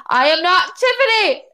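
high, loud mock-outraged protest; indignant retold yell. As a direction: act out yelling a fed-up denial.

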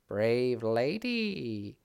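slow, drawn-out magical bird; solemn bird, very slow; talking bird voice. A narrator's reading.

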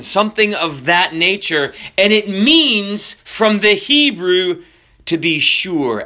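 normal talking voice

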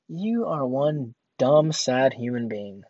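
drawn-out, deliberate disdain; slow, pitying put-down; slow scorn. Retold or imitated. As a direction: stretch each word with contempt. Scornful thought about customer.

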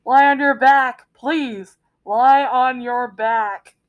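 loud, drawn-out loudspeaker call; lifeguard on the PA. Retold or imitated. Lifeguard pleads with the thrashing friend over the PA, slow and loud. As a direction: stretch the words slowly as if through a tannoy. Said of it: "'cause they're on a P A system".

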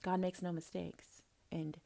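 soft catchphrase. Acted. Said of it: "the two catchphrases ... as follows, class".

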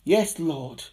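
ordinary delivery

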